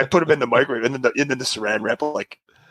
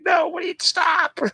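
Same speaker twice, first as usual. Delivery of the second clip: much higher, urgent repeated no; alarmed shout. Retold or imitated. To daughter putting foil in microwave, alarmed shout. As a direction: yell to stop her right now.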